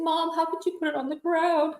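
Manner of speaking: put-on high, hurt kid's voice; lightly voiced upset boy; mimicking upset son. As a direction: plead like a wounded child.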